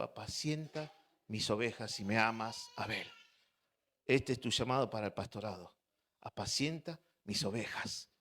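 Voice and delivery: low, soft voice of God speaking; hushed solemn calling. Retold or imitated. The Lord calls the preacher to pastor, hushed solemn voice. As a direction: drop low and soft, as a divine word. God's word to him, retold.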